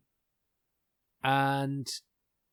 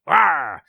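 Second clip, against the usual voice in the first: loud, higher-pitched mock roar; blustering roar; 6 semitones higher, 15 dB louder than usual. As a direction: let out a big comic "rawr". Imitates a forceful Captain Trask.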